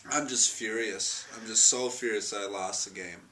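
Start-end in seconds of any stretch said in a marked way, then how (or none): none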